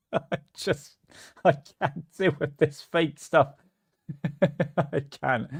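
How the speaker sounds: breaking up with laughter while talking; can barely get it out for giggling; real helpless chuckles between words; laughing. Real, not acted. Cracks up over fake spheres.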